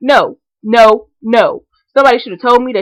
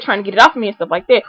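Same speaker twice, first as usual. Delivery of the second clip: ordinary talk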